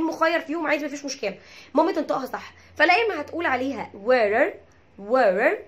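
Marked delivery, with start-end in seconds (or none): 4.1-5.6 "wearer" standing for water in American accent, T as a tapped r. Shown as a demonstration. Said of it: "بالامريكان اكسنت".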